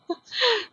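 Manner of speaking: quick high-pitched giggle; brief squeaky laugh. Real, not acted. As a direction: burst into a short giggle. Laughing at mishap.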